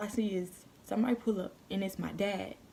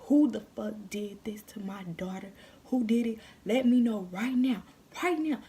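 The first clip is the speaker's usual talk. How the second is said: imitating an angry dad, louder and rising; mad, cussing; 2 semitones higher, 5 dB louder than usual. Daughter imitates dad demanding who hurt her, mad and cussing. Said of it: "My dad's mad. He's upset. He's cussing".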